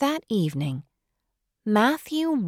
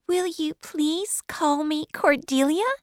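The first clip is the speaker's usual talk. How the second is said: put-on high child's voice asking a wistful favour; hopeful girl; 10 semitones higher than usual. Girl asks Marilla to call her Cordelia, high and hopeful. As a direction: ask dreamily, like a child wishing.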